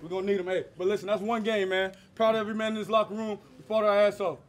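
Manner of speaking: loud fired-up locker room speech; rally the team, loud and fast; halftime pep speech. Real, not acted. Player fires up locker room.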